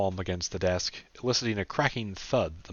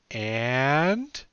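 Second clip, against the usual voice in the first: put-on higher young woman's voice; Sarah's voice; 6 semitones higher, 4 dB louder than usual. A narrator's reading. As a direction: pitch it up for Sarah.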